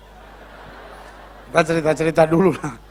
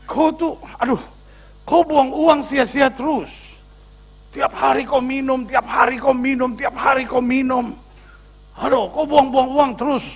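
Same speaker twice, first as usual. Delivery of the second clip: mock wifely scolding, pitched higher; hammed-up higher-pitched nagging; nagging angry wife; 5 semitones higher than usual. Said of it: "istrinya marah-marah sama dia".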